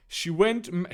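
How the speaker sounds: ordinary delivery, nothing marked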